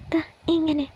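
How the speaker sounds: affectionate mock protest; coy, babyish teasing. A narrator's reading. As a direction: playfully shoo him away, coyly. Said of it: "അവൾ കൊഞ്ചിക്കൊണ്ട് പറഞ്ഞു".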